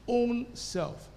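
single out "own" as the key word; stress on OWN. Shown as a demonstration. Preacher quotes the Bible's word, emphasis on "own". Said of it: "making the emphasis key".

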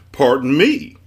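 loud "Pardon me" rising on "me"; indignant echo. A narrator's reading. Man echoes Milo's greeting, loud and questioning. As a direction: throw it back loudly as a question.

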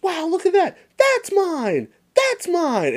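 put-on little kid showing off his toys; little brother Randy. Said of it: "he turns and goes".

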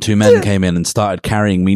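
ordinary delivery

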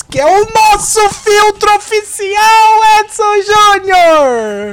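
very high, slow, stretched shout; announcer call. Acted. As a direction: draw the name out like a ring announcer. Host announcing co-host.

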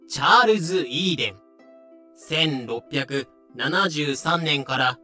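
ordinary talk, nothing marked

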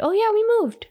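high chirpy aunt on the phone. Retold or imitated. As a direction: pop up high as the cheerful aunt. Aunt calls with the news.

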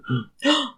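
high dramatic mock gasp; hammed-up horrified gasp; shocked gasp. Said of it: "Barbara and Susan go".

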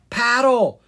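loud, high shout of command; calling out. A narrator's reading. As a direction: call it out sharply to a fellow paddler. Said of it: "Kara called to Zed".

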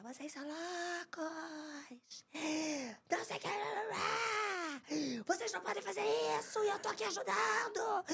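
panicked half-crying shout at the robbers; retold screaming. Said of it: "eu comecei a gritar".

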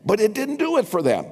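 usual speaking voice

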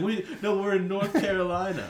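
high, loud retort through amusement; mimicked bench protest. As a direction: insist loudly as the players, hammed-up. Acted back-and-forth with the bench.

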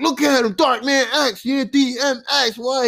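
high-pitched, excited fan imitation; hyped. Friend raves about [name]'s look, hyped. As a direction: gush like a friend obsessed with a rapper. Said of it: "He was hyped".

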